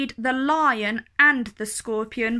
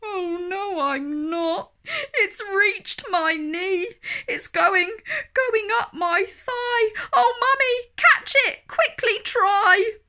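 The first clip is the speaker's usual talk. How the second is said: put-on a terrified kid begging mummy to catch it; hammed-up child's fearful wail; panicking child; 9 semitones higher than usual. Child panics as scorpion climbs.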